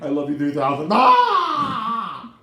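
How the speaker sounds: loud high wail after the line; mock sobbing wail. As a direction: break into an overwhelmed cry. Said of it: "I was just like".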